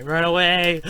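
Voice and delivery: high, loud cry to flee; urgent yell. Real, not acted. Urges escape from the creature.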